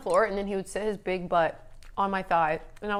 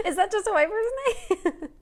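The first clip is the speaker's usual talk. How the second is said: laughing; 12 semitones higher, 4 dB louder than usual. Real, not acted. Laughing while asking.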